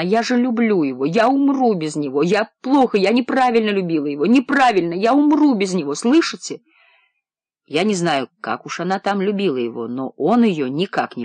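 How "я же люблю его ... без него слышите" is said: high, swelling wail in waves; lamenting. A narrator's reading. Woman laments the lover she sent away, wailing. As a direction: wail it louder and higher, phrase after phrase. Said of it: "причитала она".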